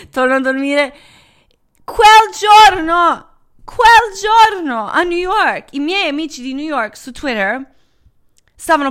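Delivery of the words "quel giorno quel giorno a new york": high, loud, slow buildup; dramatic emphasis. Real, not acted. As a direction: land it slowly and loudly. Dramatic reveal of the flood.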